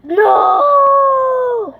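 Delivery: very high, very loud scream; frustrated scream. Real, not acted. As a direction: shriek no in frustration. Dies in game, screams.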